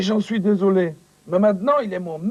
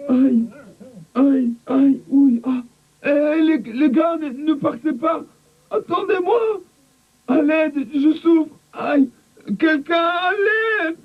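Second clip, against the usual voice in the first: pained crying for help; 7 semitones higher, slower than usual. Acted. Coco hurt, calling for help.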